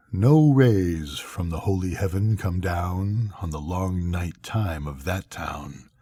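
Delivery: usual speaking voice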